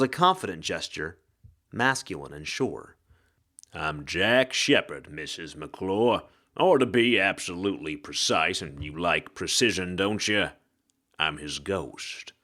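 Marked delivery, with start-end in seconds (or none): 3.7-12.3 hard-boiled ghost. Acted. Ghost introduces himself.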